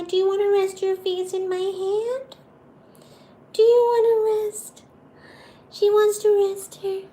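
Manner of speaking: high sing-song pet talk. Real, not acted. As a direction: coo to the cat. Baby talk to the cat.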